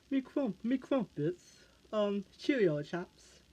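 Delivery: high, slow put-on British accent, teasing; mock British accent. Mocking how some people think British folks talk, hammed-up British accent. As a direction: lampoon a British accent for a laugh. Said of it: "'cause I know with a British accent".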